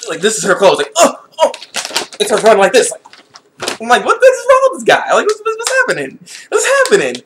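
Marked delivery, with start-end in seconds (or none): 1.0-1.5 mock startled groan. Imitating character's reaction. 4.0-7.2 high laughing outburst; shouting in disbelief. Retold or imitated. Shocked reaction to character.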